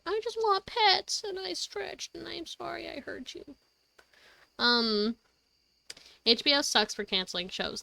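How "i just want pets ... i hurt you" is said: high, soft little cat voice; voicing the cat. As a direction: speak for the cat, higher and fading. Voicing the cat's apology.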